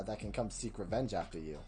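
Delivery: usual speaking voice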